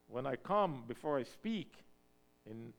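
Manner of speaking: ordinary talk, nothing marked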